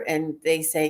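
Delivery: normal talking voice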